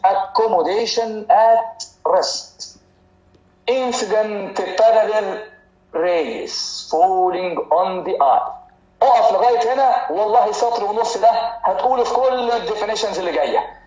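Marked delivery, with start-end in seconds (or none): 0.1-8.5 very slow, word by word, like dictating to a class; measured dictation of a textbook definition. Shown as a demonstration. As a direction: spell the definition out slowly so students can copy. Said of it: "تقول لي".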